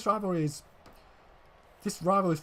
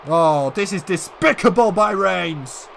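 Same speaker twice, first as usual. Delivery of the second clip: furious, much higher and louder ringside yelling; outraged shouting; 7 semitones higher, 11 dB louder than usual. Acted. Commentator yelling for security after Reigns' attack, furious shout. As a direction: scream at security like the attack just happened in front of you.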